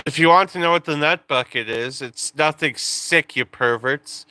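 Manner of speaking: ordinary talk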